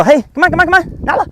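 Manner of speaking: high, coaxing dog call; excited recall. Shown as a demonstration. Trainer calling the dog to come, excited. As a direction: coax the dog over in a bright squeak. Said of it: "then call away from that".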